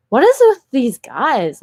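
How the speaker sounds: high, loud, drawn-out exasperation; retold exasperated outburst. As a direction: act out baffled disbelief, stretching the words. Acted exasperated reaction to the guys.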